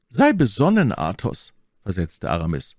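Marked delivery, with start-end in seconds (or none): 0.1-1.5 high, loud caution to a friend; raised, insistent appeal; urgent warning. A narrator's reading. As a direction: urge prudence in a lifted voice. Aramis urges Athos to caution.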